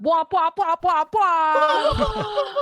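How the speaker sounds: high, loud womping rotor sound; helicopter noise. Pretend. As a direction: whump the blades like a chopper. Dragon Lily swoops down.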